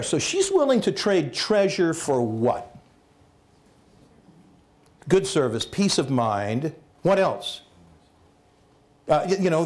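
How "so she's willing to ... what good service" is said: ordinary delivery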